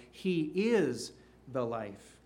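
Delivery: "is" pressed, whole line slow; stress on IS, slow. Acted. Preacher's climax to the congregation, slow stress on IS. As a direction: land hard on "is", slowly. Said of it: "not simply and merely".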